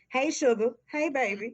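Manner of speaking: put-on sweet Southern accent, calling a customer sugar; Southern twang greeting. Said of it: "that Southern twang".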